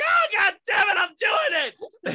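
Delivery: high, loud shout giving in to tears; crying out. Retold or imitated. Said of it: "I'm like".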